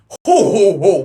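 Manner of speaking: loud, high, hammed-up belly chortle; Taft impression. Comic playing a fat president, loud mock chortle. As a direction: boom out a cartoon fat-man ho ho. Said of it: "you don't got a Taft in there?".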